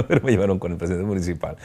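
ordinary talk, nothing marked

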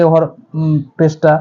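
ordinary talk

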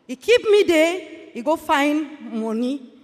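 normal talking voice

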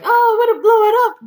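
shrieking high and loud with glee; imitating excited glee. Gamer squealing as he imagines blowing up TNT. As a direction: squeal it like a kid about to blow up TNT. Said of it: "It's like".

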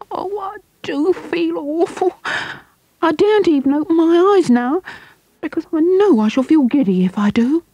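put-on high, giddy scared boy; frightened boy. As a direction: play the dizzy boy with eyes shut.

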